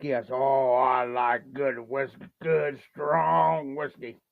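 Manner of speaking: low, slow, smug bragging voice; bragging guy. Retold or imitated. Imitating Scott bragging about strong whiskey, low and slow. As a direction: play the bragging whiskey snob, deep and drawn out. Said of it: "he likes to brag".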